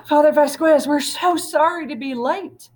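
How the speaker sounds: loud, high, breathless apology; calling out. Acted. Lupe and Roberto calling to the priest, loud and high. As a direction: call out in a rush. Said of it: "calling out as they rushed".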